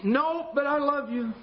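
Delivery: slow, breezy mock refusal; lazy refusal. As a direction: shrug it off from the recliner. Husband refuses again.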